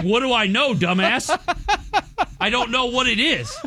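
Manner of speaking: high-pitched, annoyed comeback; exasperated retort. Retold or imitated. Boyfriend answering girlfriend's TikTok question, mock exasperated.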